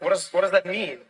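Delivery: baffled retold question. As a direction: ask it in mock bafflement. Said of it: "And I was like".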